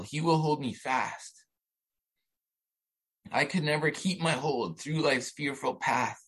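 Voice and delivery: moved to tears, pausing to keep composure; real tearful reading. Real, not acted. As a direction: read the hymn slowly while holding back tears. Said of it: "I can't read it without crying".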